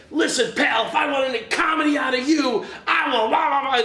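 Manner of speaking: imitating an irate drunk, loud and high; retold angry rant. Drunk man starts in on him.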